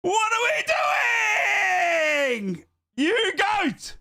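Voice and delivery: high, loud, cracking cries of disbelief; overjoyed shout. Real, not acted. Streamer stunned by a hundred pound donation, shrieking with joy. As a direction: scream out your shock and joy.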